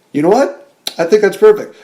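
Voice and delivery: excited. Retold or imitated. Retold director delighted.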